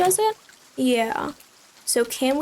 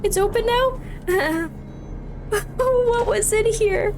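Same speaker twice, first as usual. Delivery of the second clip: scared laughing cry; 7 semitones higher, slower than usual. Real, not acted. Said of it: "I'm genuinely scared right now".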